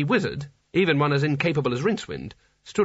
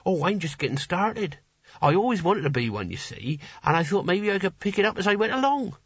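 higher voice of a keen beginner; eager would-be hero Nigel; 6 semitones higher than usual. Acted. Nigel tells Rincewind of his hero ambitions, as a keen novice. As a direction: play an earnest novice barbarian, pitch lifting.

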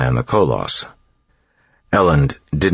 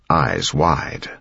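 ordinary delivery, nothing marked

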